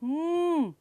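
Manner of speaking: long "ਹਮ" as a closed-lip mmm; sustained "ਹਮ" hum; M sound. Shown as a demonstration. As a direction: hold "ਹਮ" with no vowel. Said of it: "next sound is".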